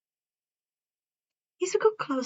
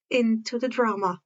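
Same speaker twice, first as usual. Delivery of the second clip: ordinary delivery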